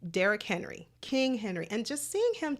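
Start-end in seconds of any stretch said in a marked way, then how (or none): none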